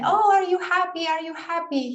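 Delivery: a lightly voiced cheerful teacher; imitating the teacher. Lecturer imitating the teacher asking children if happy. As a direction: echo the teacher's upbeat question. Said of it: "the teacher, when he was saying".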